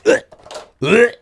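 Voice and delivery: mock retching grunts; imitated dry heave. Friend retching the morning after, imitated dry heaves. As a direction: gag in short, separate bursts. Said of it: "he starts dry heaving".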